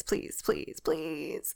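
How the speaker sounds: pleas fading to a desperate whisper; whispered pleading. Retold or imitated. Begging God in a whisper to let her go. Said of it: "being like".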